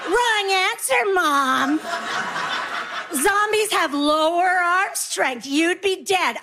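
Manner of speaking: put-on high, drawn-out know-it-all child; singsong correction, stretched out slowly; imitated child scolding a parent; scornful kid. Kids scornfully correcting mom.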